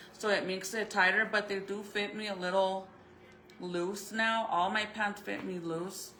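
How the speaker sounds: ordinary delivery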